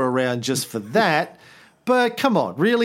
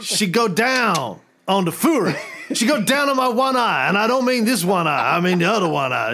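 mock-boastful Fury holding court; Nick Fury voice; 2 semitones higher than usual. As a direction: brag it through laughter. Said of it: "Nick Fury was like".

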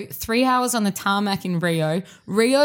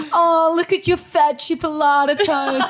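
high, loud exaggerated teasing; mock the swollen toes with a big high swoop; mocking friends; 8 semitones higher, 5 dB louder than usual. Friends teasing her about swollen toes, mocking. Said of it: "everyone goes to me".

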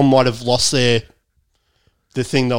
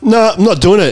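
higher, firm no; defiant refusal; 7 semitones higher, 3 dB louder than usual. Retold or imitated. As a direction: refuse flatly and high. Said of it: "I was like".

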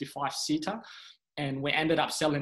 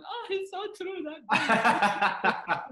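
very high, drawn-out mock wail; hammed-up mother agreeing, dissolving into laughter; mom impression; 14 semitones higher, 4 dB louder, much slower than usual. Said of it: "I'm sharing this on stage and she's going".

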